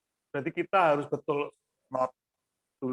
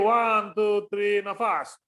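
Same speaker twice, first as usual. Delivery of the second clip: loud, higher count-in; 4 semitones higher, 4 dB louder, much slower than usual. Real, not acted. Choir coach cueing singers to breathe, counting in loudly. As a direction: call the count out, then cue the breath.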